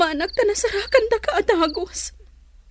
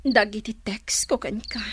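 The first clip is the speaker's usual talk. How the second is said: hurt whisper; 11 semitones lower, 7 dB quieter than usual. Acted. Hurt lover confronting Roman.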